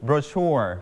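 brochure, said slowly and clearly for the class to copy; teacher models brochure for students to repeat, clearly; model pronunciation. Shown as a demonstration. สาธิตการออกเสียง. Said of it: "repeat after me".

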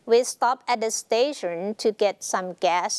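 drawn-out, careful reading; slow example reading. Shown as a demonstration. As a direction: model the sentence for learners. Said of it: "teacher reads example slowly". (อ่านประโยคช้าๆ เป็นตัวอย่าง)

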